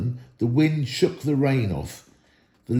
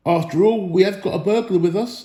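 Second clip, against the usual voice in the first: higher, louder and quicker; eager decision; 6 semitones higher, 3 dB louder than usual. A narrator's reading. Dwarves settle the argument, eager and quick. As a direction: rush it out, glad to decide. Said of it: "they said".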